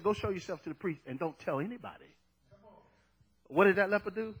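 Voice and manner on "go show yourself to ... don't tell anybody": low, quick, soft recitation; quiet quote. Retold or imitated. Jesus instructing the healed leper, quiet quote. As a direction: rattle it off softly and low.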